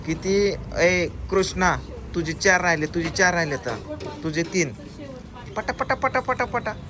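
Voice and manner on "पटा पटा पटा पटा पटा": higher quick chant of पटा; excited urging. Real, not acted. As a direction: cheer them on: पटा पटा. Cheering kids to eat fast.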